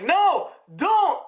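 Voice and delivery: high-pitched, urgent retold plea; mock alarmed protest. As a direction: act out a desperate objection. Acted desperate protest.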